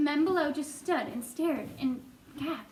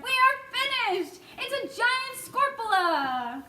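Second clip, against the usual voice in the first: panicked fire chief; 8 semitones higher than usual. A narrator's reading. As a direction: wail that all is lost. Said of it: "yelled the head of the fire department".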